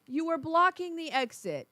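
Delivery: pestering safety correction; insistent nagging reminder. Acted. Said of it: "Reese will say this, and he hates it".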